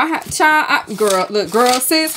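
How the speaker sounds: normal talking voice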